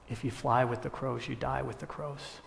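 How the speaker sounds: hushed, solemn verdict; quiet, regretful pronouncement; grave, sorrowful farmer. Acted. Tearful farmer's grave final answer.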